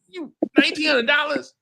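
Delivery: high, loud scoff at the price; outraged disbelief. Real, not acted.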